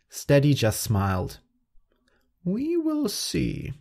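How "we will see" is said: slow, high whisper. Acted. Steady quietly answering Speedy's boast in a high whisper. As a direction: breathe it out slowly, high and hushed.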